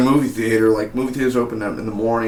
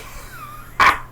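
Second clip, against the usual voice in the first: villain laugh. Retold or imitated.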